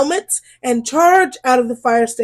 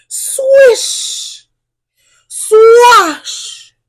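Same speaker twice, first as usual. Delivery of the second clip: spraying sound; 9 semitones higher, 5 dB louder than usual. A narrator's reading. Hose spraying sound words.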